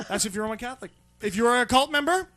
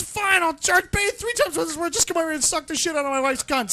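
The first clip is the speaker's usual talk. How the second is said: pleading husband; 6 semitones higher, 4 dB louder than usual. Retold or imitated. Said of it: "So he says".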